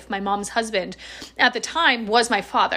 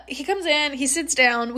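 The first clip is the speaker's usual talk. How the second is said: normal talking voice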